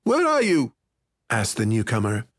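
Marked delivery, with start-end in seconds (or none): 0.0-0.7 high, impatient call; irritable boy. A narrator's reading. Boy unable to find his friend, irritably calling. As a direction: call out crossly in a raised boy's voice. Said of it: "He sounded irritable".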